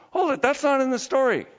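high, falling protest of disbelief; mock objection. Preacher objects to the verse just read, mock disbelief. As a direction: break in as if caught out.

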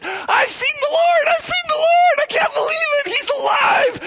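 very high, loud joyful shouting; Mary shouting the news to the disciples; ecstatic shout. Acted. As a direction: cry it out in overjoyed disbelief. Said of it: "She was so excited".

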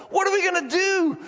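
high-pitched frantic question; mock panic. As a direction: imitate Israelites at their wits' end. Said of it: "they're panicking".

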